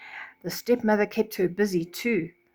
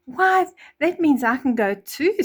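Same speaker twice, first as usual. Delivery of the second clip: higher, louder eager hope; excited Cinderella; 6 semitones higher, 5 dB louder than usual. Acted. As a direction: burst out with hope. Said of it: "Cinderella said".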